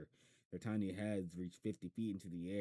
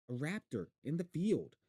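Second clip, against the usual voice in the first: excited; 8 semitones higher, 6 dB louder than usual. Acted. Boy excited about raptor.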